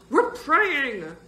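loud, high, stretched-out repeat; emphatic declaration. A narrator's reading. Boy insisting to his friend that he prays, loud high declaration. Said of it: "Bird declared".

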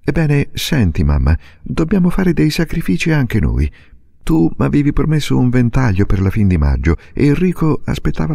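a daughter firmly persuading her mother; young girl Silvia. A narrator's reading. Narrator voices Silvia. (bambina decisa che convince la madre)

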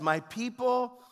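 high, slow, forceful declamation; impassioned scripture reading, pitched high; God lamenting. Acted. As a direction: declaim God's complaint against the people. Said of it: "He says".

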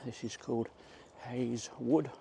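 normal talking voice

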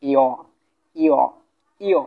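teacher's top-to-bottom tone on "ইয়"; falling tone. Shown as a demonstration. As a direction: say "ইয়" as an isolated falling syllable. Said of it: "উপর থেকে নিচে আমি উচ্চারণ দিতেছি".

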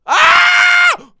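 long high full-force scream; scream of fright. Real, not acted. Player is jump-scared, screaming in fright. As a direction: scream loud and sustained.

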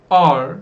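are said loud and held; stressed are. Shown as a demonstration. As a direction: hit the word are hard as the key word. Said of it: "Çoğul olduğu için are kullanmam lazım".

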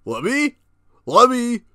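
ordinary talk, nothing marked

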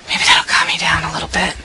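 low calming whisper. Acted. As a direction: whisper to settle yourself. Trying to calm down.

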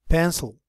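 pencil said carefully for the class; clear pencil. Shown as a demonstration. Teacher models pencil for the class, carefully. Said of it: "Tengan cuidado al pronunciar la palabra pencil".

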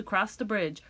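usual speaking voice

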